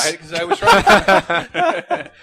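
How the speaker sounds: high, slow laughing words; real giggling. Real, not acted. Laughing while explaining.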